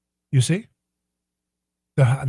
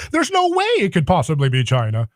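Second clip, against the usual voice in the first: usual speaking voice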